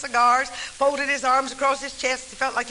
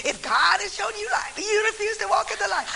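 higher, pointed quoting of the warning; pointed quote; 7 semitones higher than usual. Retold or imitated. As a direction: throw his own words back at him, still at normal volume. Preacher's words quoted back.

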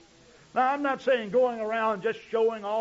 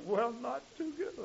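sulky, deflated answer; gloomy reply; 4 semitones higher, 8 dB quieter than usual. Retold or imitated. Acted gloomy reply after work.

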